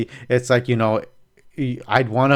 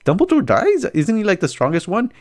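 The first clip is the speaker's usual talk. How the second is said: shocked; 8 semitones higher than usual. Retold or imitated. Speaker's own shocked reaction.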